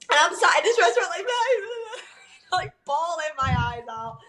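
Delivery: giggling through the memory of bawling; laughing. Real, not acted. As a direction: laugh at yourself while telling it. Laughing at her own crying story.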